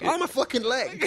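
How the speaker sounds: high, laughing mock yell; mock yell while laughing. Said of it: "so he yelled out".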